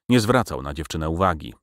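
ordinary delivery, nothing marked

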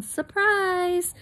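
high cheerful chorus of Surprise; group shout of surprise. A narrator's reading. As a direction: everyone shouting it together. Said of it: "everybody else yelled".

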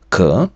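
"к" as a bare k sound; French qu sound. Shown as a demonstration. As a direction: model "к" with no u after it. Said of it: "яке читається як".

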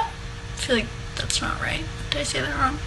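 unsure whisper to herself; hushed whisper checking a name. Real, not acted. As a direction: breathe it out, no voice, doubting the name.

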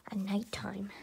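whispered threat. Acted. Plotting revenge quietly.

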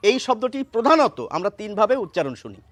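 usual speaking voice

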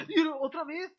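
high, loud cry of disbelief; loud rising exasperation; exasperated outburst. Real, not acted. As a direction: throw the words up in frustration. Pokémon flinches again.

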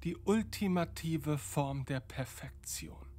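breathy unvoiced whisper. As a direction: whisper it like a secret. Q whispering about assimilation.

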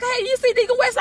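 high, eager call to a friend; buzzing, high-pitched question; excited. Retold or imitated. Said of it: "I get excited too".

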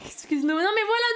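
high, loud mock indignation; réaction outrée imitée. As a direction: mimic people reacting, pitch climbing. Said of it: "ils réagissent comme ça". (imitation outrée, voix aiguë et forte)

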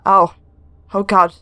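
ordinary talk, nothing marked

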